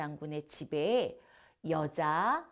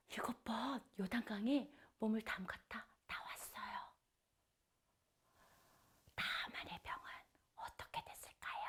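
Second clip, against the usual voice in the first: whispered storytelling, much softer; hushed suspense; 5 semitones higher, 8 dB quieter than usual. Acted. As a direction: draw the children in with a voiceless hush. (아이들에게 속삭이는 긴장감 있는 이야기)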